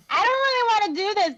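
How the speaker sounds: mock whiny reluctance, pitched well up; reluctant protest. Neville to bossy partner, reluctant protest. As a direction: protest in a high, hurried voice.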